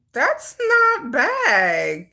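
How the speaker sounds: "that's not bad" stretched very slowly; long drawn-out. Real, not acted. Judging the backpack's price, "that's not bad" long drawn-out. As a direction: drag out each word of "that's not bad".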